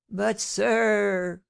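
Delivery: mildly acted cry of dismay; unhappy cry. A narrator's reading. Unhappy porter pleading with the inquirer, a crying protest. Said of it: "cried the unhappy porter".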